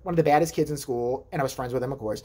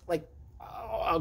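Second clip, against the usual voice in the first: ordinary talk, nothing marked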